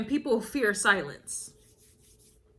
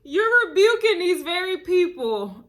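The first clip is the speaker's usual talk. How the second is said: laughing it out, louder and higher; cracking up mid-sentence; 6 semitones higher, 5 dB louder than usual. Real, not acted. Reader cracking up at her own reading.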